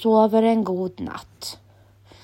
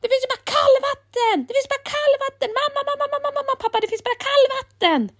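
fast, much higher complaint; upset crying out; faster than usual. A narrator's reading. Emil in the cold shower calling his parents, high and upset. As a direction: wail in alarm for mum and dad. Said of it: "skriker Emil".

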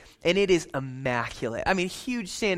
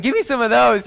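loud, higher, gleeful orders to the waiter; gleeful ordering; 7 semitones higher, 7 dB louder than usual. Pretend. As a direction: boom it out like a king ordering a feast. Ordering like a king.

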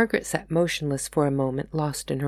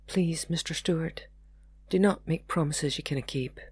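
subdued plea; 5 dB quieter than usual. A narrator's reading. Margaret, tearful, pleads with Martin.

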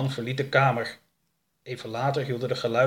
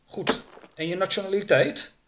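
raised-pitch official question; questioning henchman; 8 semitones higher than usual. Acted. Jake to captive at the laptop, questioning. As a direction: interrogate in a lightly put-on higher voice.

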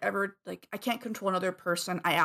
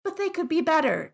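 pleading empathy voice; 9 semitones higher, 5 dB louder than usual. Retold or imitated. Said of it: "my empathy goes".

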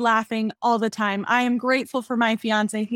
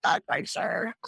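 unsure whisper; 6 dB quieter, slower than usual. Retold or imitated. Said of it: "we were like".

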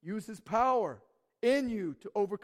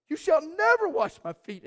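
shouted, much higher and louder; outraged no; 10 semitones higher, 11 dB louder than usual. Acted. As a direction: Peter flings out a horrified refusal. Peter refuses Jesus.

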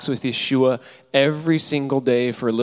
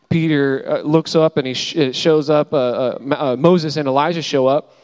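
ordinary talk

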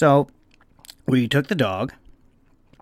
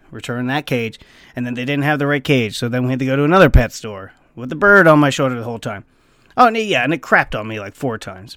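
ordinary delivery

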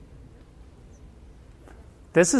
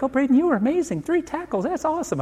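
much higher, gushing over-the-top praise; gushing mom; 11 semitones higher than usual. Retold or imitated. Mother gushing to her son about his three tackles, high and gushing. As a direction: coo it like a doting mother. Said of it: "Heather will be like".

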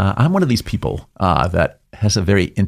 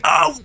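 much higher "oh" of a stubbed toe; brief high mock yelp; mock pain cry. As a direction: yelp as if you just hit your toe. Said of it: "screaming and saying".

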